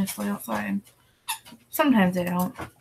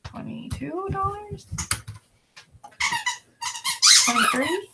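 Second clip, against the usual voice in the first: questioning pitch rise; 12 semitones higher than usual. Real, not acted. Pondering a price.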